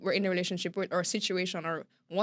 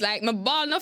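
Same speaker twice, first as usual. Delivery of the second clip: normal talking voice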